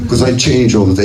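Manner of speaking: usual speaking voice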